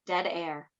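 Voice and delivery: normal talking voice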